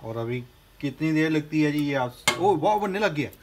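normal talking voice

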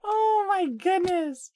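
very high laughing shriek; laughing squeal. Real, not acted. Reacting to the creepy clip.

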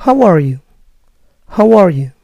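How are you with the stress on how, falling; American How are you, falling. Shown as a demonstration. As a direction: ask it the American way. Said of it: "¿Cómo estás?".

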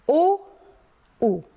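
O, U as two separate vowels; isolated vowel sounds O and U. Shown as a demonstration. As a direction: sound out O, then U, with a clear pause between. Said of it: "este alcătuită din două litere".

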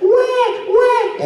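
very high-pitched imitation baby wail; crying baby. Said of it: "and she's crying".

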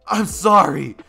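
high, very loud cry of apology; acted anguished shout. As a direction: yell it out across a fight. Deku shouts back at Bakugo.

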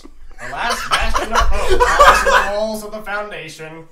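high, loud cartoon villain; grand proclamation in a high, loud villain voice; Plankton. Host reading a film synopsis to friends, cartoon villain impression. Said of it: "I'll read it in Plankton's voice".

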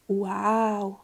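drawn-out, marvelling wow; a long, amazed exclamation; awed delight. A narrator's reading. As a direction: voice the children's wonder. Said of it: "dzieci były zachwycone".

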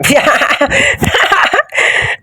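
high shrieking burst of laughter; real high-pitched laugh. Real, not acted. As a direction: crack up in shock at the screen. Gamer shocked by in-game mishap.

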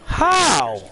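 high, loud incredulous outburst; real shocked protest at the game; loud disbelief. Real, not acted. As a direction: shout it in disbelief, pitch shooting up. Outraged at the game.

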